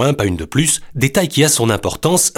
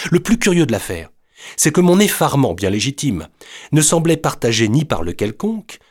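plain reading voice

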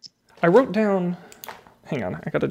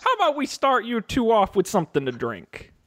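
put-on Texan bartender accent; 7 semitones higher than usual. Bartender offering the two travelers a drink, Texas accent. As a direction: offer drinks like a friendly Old West barkeep. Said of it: "This accent is".

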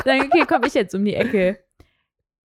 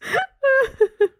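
shrieking laugh; 4 dB louder than usual. Real, not acted. Laughing at the Ross story.

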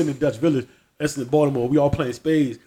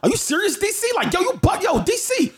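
high-pitched, repeated disbelief in imitation; mock incredulity; 12 semitones higher than usual. Retelling a schoolmate challenging D C, mock incredulous. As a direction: pester with squeaky mock astonishment. Imitating a guy questioning him.

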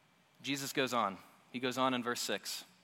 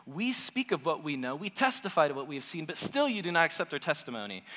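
lightly heated Jesus; 5 semitones higher, 5 dB louder than usual. Retold or imitated. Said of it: "So he says".

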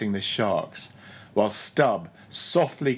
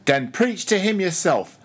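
sullen; 6 semitones higher, 4 dB louder than usual. A narrator's reading. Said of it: "sullenly turning to go".

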